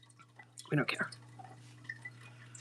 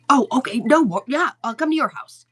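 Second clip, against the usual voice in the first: eager mock reply; 5 semitones higher, 6 dB louder than usual. Stranger's eager reply retold.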